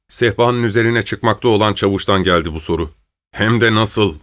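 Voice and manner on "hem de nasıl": ordinary delivery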